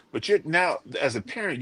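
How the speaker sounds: ordinary talk, nothing marked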